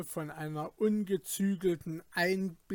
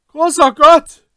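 loud, high-pitched shout of alarm; startled cry; 10 semitones higher, 20 dB louder than usual. A narrator's reading. Startled treasure hunter shouting into the empty abyss, alarmed. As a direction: cry out in sudden shock. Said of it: "schrie er".

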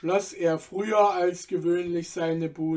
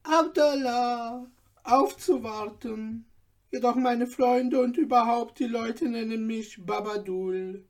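put-on higher voice of an obliging old tailor; old tailor Babadul; 5 semitones higher than usual. A narrator's reading. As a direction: play the humble old tailor, voice raised. (alter Schneider, höhere Stimme, dienstfertig)

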